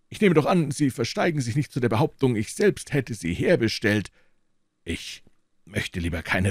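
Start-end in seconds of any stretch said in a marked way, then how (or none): 0.1-4.1 put-on a lofty old professor receiving guests; old professor's voice. A narrator's reading. Elderly professor politely greeting visitors, high and measured.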